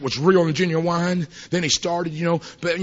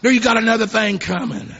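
loud, high sung preaching; chanted sermon climax; preaching at full force; 6 semitones higher, 5 dB louder than usual. Acted. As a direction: shout it out over the music like a revival preacher.

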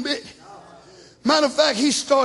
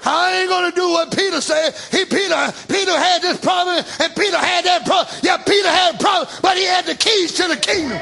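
full-force preaching; 3 semitones higher than usual. Acted. Preacher shouting to the church. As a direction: drive it home to the congregation.

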